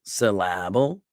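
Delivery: "syllable" as suh-LAB-ul, rising like a question; stress on LA. Shown as a demonstration. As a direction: lean on the middle "la" of "syllable", lift the end. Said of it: "emphasize the la".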